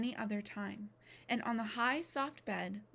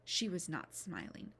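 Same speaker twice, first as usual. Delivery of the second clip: ordinary delivery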